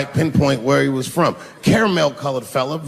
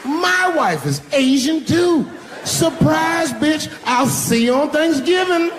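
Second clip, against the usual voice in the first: high, crowing punchline shout; gleeful high-pitched yell, stretched out at first; triumphant taunt; 12 semitones higher, slower than usual. Acted. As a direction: crow the surprise at her. Triumphant reveal shouted at absent complainer.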